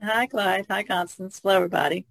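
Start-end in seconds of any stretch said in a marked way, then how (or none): none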